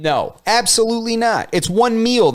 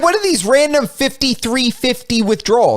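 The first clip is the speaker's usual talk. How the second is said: higher, slow disbelieving audit voice; mock incredulous host; 6 semitones higher than usual. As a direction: grill the client like the finance host. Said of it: "He's like".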